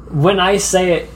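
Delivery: normal talking voice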